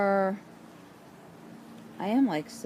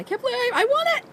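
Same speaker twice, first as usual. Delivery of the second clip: much higher, giddy; thrilled disbelief; 5 dB louder, faster than usual. Real, not acted. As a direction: squeal your disbelief. Can't believe she won.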